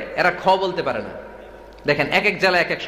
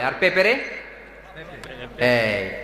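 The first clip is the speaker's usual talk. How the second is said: normal talking voice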